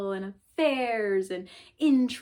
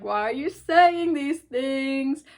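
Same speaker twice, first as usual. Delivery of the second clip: higher, louder, slower mock panic; mock nervous protest; 5 semitones higher, 7 dB louder, much slower than usual. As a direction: play up the nerves, half joking. Nervous reply to cashier.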